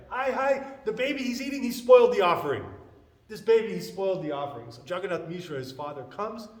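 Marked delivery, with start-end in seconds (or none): 0.1-4.7 high, loud wail of complaint; alarmed lament. Retold or imitated. Brahmana tells of the spoiled offering, dismayed outcry. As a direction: cry out in dismay at the mishap. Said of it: "Hi means in, in this, right? "Alas".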